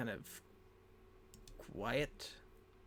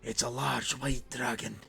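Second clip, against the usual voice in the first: louder, emphatic dwarf voice; alarmed dwarf; 6 dB louder than usual. Acted. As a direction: give a loud, dire warning. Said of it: "he looks at you going".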